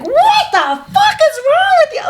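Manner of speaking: high-pitched, drawn-out mock scream with laughter in it; retold scream. Woman recounts seeing a flasher on the beach, retold screaming. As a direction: screech it high without much added volume. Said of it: "I just go off and start screaming".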